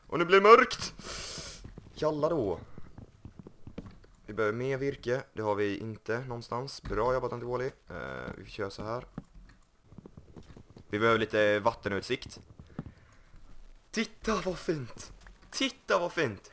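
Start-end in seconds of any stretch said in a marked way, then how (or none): 0.1-2.6 loud, high panicked shout; real startled alarm; alarmed cry. Real, not acted. Night falls in game. 13.9-16.5 slow, high cooing voice; mock sweet admiring coo; cooing admiration. Admiring new sea view.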